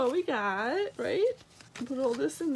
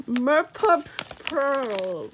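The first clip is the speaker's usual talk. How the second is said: usual speaking voice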